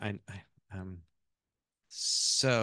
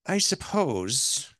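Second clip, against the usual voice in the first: put-on slow, higher, musing Irish accent; 6 semitones higher, 5 dB louder, much slower than usual. Mother-in-law considers which child she favored, Irish accent. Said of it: "that's my Irish accent".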